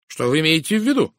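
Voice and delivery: loud, high, sharp challenge; sharp question. A narrator's reading. Woman challenged on who poisoned her friend, sharp question. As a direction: demand to know, bristling. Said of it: "резко спросила она".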